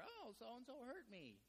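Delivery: high, slow whiny complaint; hammed-up teary grievance; mock crying adult. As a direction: imitate a grown-up whimpering. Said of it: "an adult comes in and they're crying".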